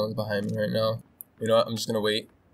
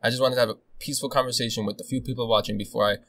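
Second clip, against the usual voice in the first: ordinary delivery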